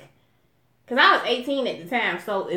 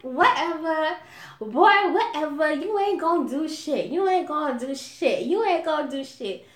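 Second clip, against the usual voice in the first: dismissive scoff; 4 semitones higher than usual. Retold or imitated. Her dismissive reply quoted.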